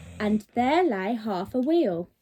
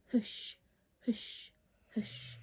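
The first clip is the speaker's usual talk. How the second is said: fading whisper; 12 dB quieter, much slower than usual. A narrator's reading. Parent reading, the sea hushes softer and softer. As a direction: hush each softer, dying away. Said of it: "and again".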